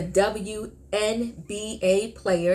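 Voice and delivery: slow, deliberate enunciation of each letter; slow, careful. Real, not acted. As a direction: spell it out slowly so nothing gets twisted. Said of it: "I had to say it slowly".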